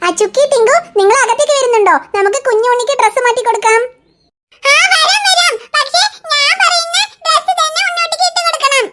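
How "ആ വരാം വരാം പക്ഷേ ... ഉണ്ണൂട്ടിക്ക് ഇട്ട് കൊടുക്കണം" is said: put-on much higher, giggly child voice; a cheeky kid claiming her turn; bossy little girl. Chukki insisting on choosing the baby's dress.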